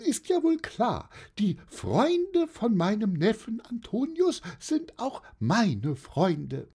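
very high-pitched aunt voice; high, friendly auntie putting a child at ease; jolly old aunt, amused. Acted. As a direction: play a chatty, good-humoured aunt way up high.

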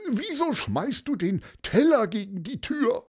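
high, shocked stammer; startled cry. Acted. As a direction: Paulus jumps at the smashed plate. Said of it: "rief er".

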